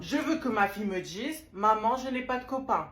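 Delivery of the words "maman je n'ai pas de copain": mother modelling her daughter's denial; model line for daughter. Shown as a demonstration. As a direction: dictate the line to be repeated. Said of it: "Avec une voix plus grave".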